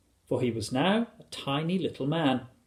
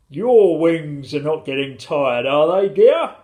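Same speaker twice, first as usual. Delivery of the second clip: loud, hearty, drawn-out question; slow, full-voiced storybook father; husband's voice; 3 semitones higher, 9 dB louder, slower than usual. A narrator's reading. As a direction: ask with booming concern. Husband's voice asking wife.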